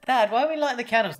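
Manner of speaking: put-on high, fast child question. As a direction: ask quickly like a kid. Child voice imitation.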